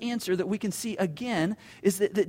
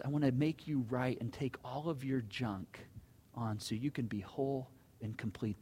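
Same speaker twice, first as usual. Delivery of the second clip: tender promise; 7 semitones lower, 9 dB quieter than usual. Retold or imitated. Jesus tenderly promising healing.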